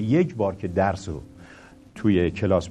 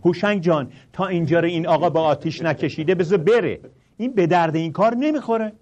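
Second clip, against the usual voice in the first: lightly voiced exasperated woman, higher; a hint of a fed-up colleague; mock exasperation, pitched up; imitating an exasperated lady; 6 semitones higher, 4 dB louder than usual. Ms Taji Ahmadi gives up, voiced.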